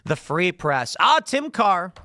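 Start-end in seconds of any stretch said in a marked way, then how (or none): 1.0-1.9 mock delight. Real, not acted. Mock greeting, louder and higher.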